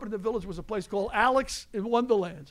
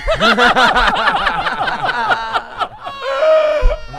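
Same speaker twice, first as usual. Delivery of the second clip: loud, high roaring laugh; the whole table bursting out laughing; 13 semitones higher, 13 dB louder than usual. Real, not acted. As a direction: crack up hard at the punchline. Big laugh after punchline.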